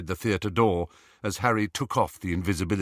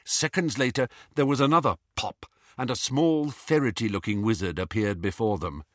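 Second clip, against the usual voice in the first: ordinary delivery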